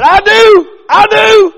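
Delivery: put-on high, loud, eager little boy shouting; a kid bursting to answer first. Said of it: "raised his hand and said".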